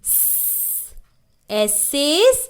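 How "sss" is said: स as a long voiceless "sss"; soft sustained hiss on स; pure S sound. Shown as a demonstration. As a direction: hiss स with no vowel. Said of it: "हमें क्या बोलना है?".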